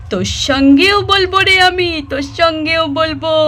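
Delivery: loud, strange possessed voice; possessed girl. Acted. Possessed Sweety threatens her sister-in-law in a strange voice. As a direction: threaten in a voice not her own. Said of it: "এসব কি ব্যবহার বোন?".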